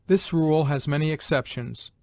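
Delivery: ordinary talk, nothing marked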